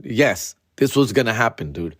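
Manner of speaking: normal talking voice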